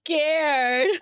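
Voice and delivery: slow, drawn-out teasing; baby the big strong man, slowly; mock admiration. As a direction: tease with stretched-out fake praise. Teasing Benny for denying fear.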